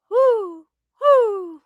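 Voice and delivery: high hooting owl imitation; mock owl call. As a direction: hoot like an owl.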